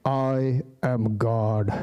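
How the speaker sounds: very slow, low divine announcement; God. Acted. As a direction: declare it solemnly, drawing out each word. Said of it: "God had a very solid masculine voice".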